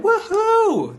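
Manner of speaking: high, whooping cheer. A narrator's reading. All the kids celebrating George, high cheer. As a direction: whoop like a crowd of delighted kids. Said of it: "all the kids cheered".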